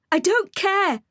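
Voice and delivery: high shouted retort; high sharp shout of defiance; shouted back. A narrator's reading. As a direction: yell it back at him. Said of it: "I shouted back".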